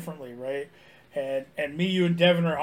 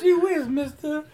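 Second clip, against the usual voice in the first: put-on wholesome 1950s kid; 6 semitones higher than usual. Repeating the kid catchphrase.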